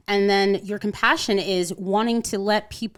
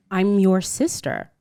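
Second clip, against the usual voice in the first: ordinary talk, nothing marked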